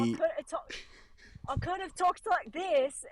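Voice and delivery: put-on British accent. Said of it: "talking like this".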